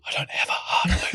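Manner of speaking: hushed mock confession; soft, unvoiced admission; whispered confession. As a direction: whisper it like a secret. Mock confession of being robot.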